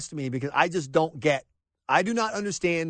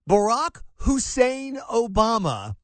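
ordinary talk

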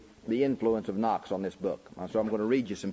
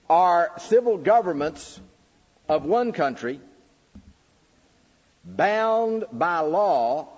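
raised, slow, emphatic preaching of each phrase; preaching the questions; 7 semitones higher, 5 dB louder than usual. Acted. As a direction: declaim every question like a sermon point. Reads the fourth question.

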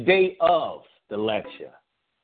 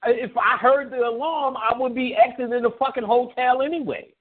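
mock outraged; 9 semitones higher than usual. Retold outrage at caller.

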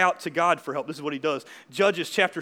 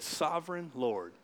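reverent hush; 6 semitones lower, 12 dB quieter, slower than usual. Shown as a demonstration. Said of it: "you know what he did".

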